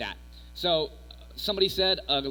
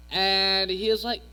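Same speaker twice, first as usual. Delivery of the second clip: voice crack; 4 semitones higher, 4 dB louder, slower than usual. Real, not acted. Speaker telling the youth group a story, voice cracking. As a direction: voice breaks upward on the words. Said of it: "there's a voice crack".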